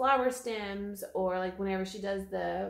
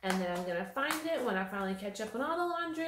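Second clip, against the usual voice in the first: usual speaking voice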